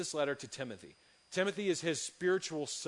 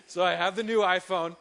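high laugh, then louder words through the laugh; 4 semitones higher, 9 dB louder than usual. Real, not acted. Laughs while confessing another upgrade.

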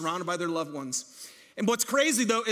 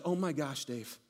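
awed hush; 6 semitones lower, 7 dB quieter than usual. Retold or imitated. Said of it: "He's like".

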